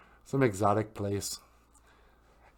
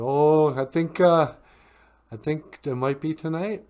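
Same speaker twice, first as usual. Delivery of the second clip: mild alarm; 6 semitones higher, 6 dB louder than usual. Acted. Mary feeling contractions, telling Joseph.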